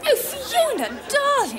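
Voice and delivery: high-pitched society gush; effusive greeting. Acted. Hostess greets a guest at her party, effusive.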